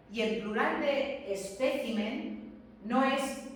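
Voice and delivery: ordinary talk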